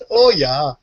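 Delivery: long rounded northern O; northern accent. Acted. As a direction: echo the northern accent playfully. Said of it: "with that northern accent".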